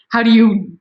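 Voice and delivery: usual speaking voice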